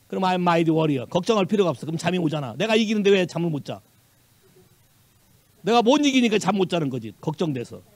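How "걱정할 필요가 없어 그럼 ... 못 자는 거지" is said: normal talking voice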